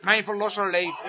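normal talking voice

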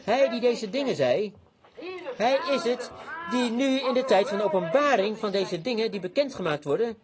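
ordinary delivery

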